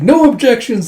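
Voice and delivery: high, loud declaration; retold boisterous ruling; emphatic decree. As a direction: shut down debate with a shout. Retold loud decree after proclamation.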